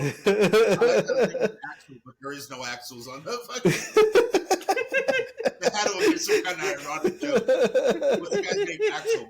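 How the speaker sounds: high, loud, laughing. Real, not acted. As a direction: crack up while talking. Laughing at Axel joke.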